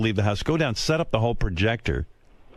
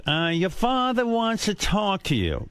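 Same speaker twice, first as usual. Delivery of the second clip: higher, drawn-out mother voice; mother's summons; 9 semitones higher, slower than usual. Retold or imitated. Telling the son his father wants him, put-on mother voice. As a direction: slowly call out higher, as a mother.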